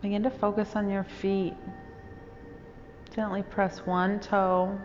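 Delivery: calm, even meditation voice with long pauses; guided meditation. Real, not acted. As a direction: guide listeners unhurriedly into stillness, phrase by phrase.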